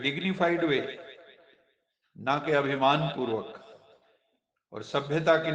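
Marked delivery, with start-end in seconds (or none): none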